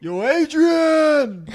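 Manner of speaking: high, loud, drawn-out yell; Rocky Balboa impression. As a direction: bellow it like Rocky. Quoting Rocky loudly.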